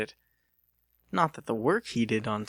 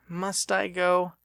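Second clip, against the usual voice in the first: slow, higher reluctant plea; 7 semitones higher, slower than usual. Acted. Lucas begging his boss to let him stay in. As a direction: drag it out, hoping to stay home.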